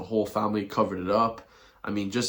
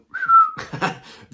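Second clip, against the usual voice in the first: mocking laugh; 7 dB louder than usual. Real, not acted. Mocking laugh mid-rant.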